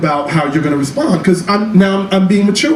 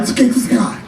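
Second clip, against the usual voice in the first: whispered reassurance; whispered self-encouragement; 7 semitones higher than usual. Retold or imitated. Coaching himself in a whisper to say hi. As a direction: breathe it out to yourself.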